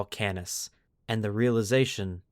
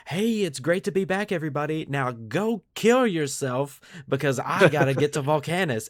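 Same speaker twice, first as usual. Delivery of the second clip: hammed-up cheerful commander, high and bright then brisk; breezy officer sending his troops off to die; mock Yarrick; 6 semitones higher, 4 dB louder than usual. Host mocking Yarrick's cheery send-off, hammed-up commander voice. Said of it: "he's like".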